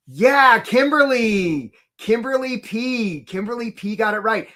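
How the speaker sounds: high, drawn-out cheer of recognition; excited congratulations. Real, not acted. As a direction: call out the winner's name with delight. Cheering the quiz winner.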